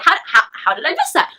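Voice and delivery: normal talking voice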